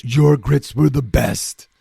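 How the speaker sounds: slow, drawn-out mock praise; exaggerated flattery. Guest playing the game with his host, slow exaggerated flattery. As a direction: lay it on thick, word by word. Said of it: "smart enough to play the game".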